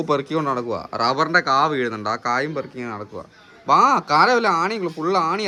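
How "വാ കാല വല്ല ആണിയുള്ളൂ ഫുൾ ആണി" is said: calling out. Real, not acted. Calling the boy to come in.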